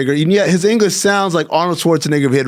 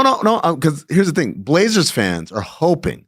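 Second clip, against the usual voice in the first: ordinary talk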